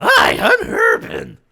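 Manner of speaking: high, slow cartoon princess; sweet squeaky princess voice; Princess Daisy voice. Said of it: "the Princess Daisy voice".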